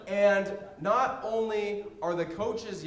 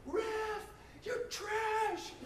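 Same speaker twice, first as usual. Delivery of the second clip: high, whiny heckling with a laugh; hammed-up sideline heckler; mock heckler; 9 semitones higher, 8 dB quieter than usual. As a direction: jeer at the ref like an angry parent. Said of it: "Someone said that".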